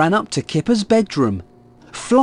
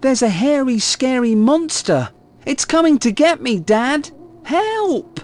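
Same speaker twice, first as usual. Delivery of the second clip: put-on child yelling; 9 semitones higher than usual. A narrator's reading. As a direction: play a child crying wolf. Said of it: "he yelled".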